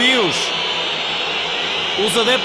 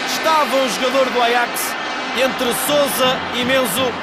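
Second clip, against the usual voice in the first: raised, energetic match commentary; excited play-by-play; 5 semitones higher than usual. Real, not acted. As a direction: keep the goal buzz while describing the replay. Excited replay after the goal.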